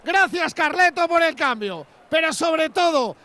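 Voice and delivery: shouted tribute to the player; excited praise. Real, not acted. Shouting praise after the goal.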